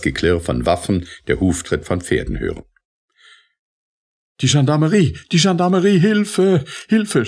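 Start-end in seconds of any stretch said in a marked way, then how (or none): 4.4-7.2 high, loud, climbing screams for help; screaming for help. A narrator's reading. Terrified servant screams to the gendarmes in the street, high and loud. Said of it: "schrie die Martinière".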